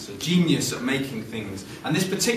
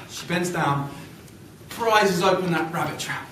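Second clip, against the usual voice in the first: usual speaking voice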